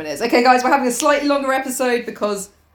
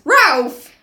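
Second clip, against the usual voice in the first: loud, higher one-word cry; mock-urgent call; 6 semitones higher, 6 dB louder than usual. Calling to Ralph mid-prayer, loud cry. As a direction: call the name out sharply.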